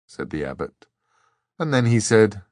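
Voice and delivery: usual speaking voice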